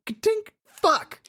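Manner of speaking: high, tiny voice mimicking a lock and a curse; comic high-pitched lockpicking fumble; failed lockpicker. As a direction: imitate a click, then a squeaky little curse. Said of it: "And then one more time".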